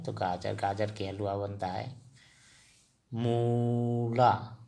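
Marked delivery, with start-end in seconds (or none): 3.1-4.6 मूला drawn out slowly and clearly; slow model word मूला for learners to repeat; Assamese word. Shown as a demonstration. Teacher presenting the Assamese word, slow and clear. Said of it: "असमिया में मूला बोलेंगे".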